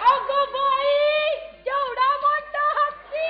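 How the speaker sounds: slow, drawn-out wonder; awed exclamation. Acted. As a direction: marvel at the huge elephant. Awed at elephant on TV.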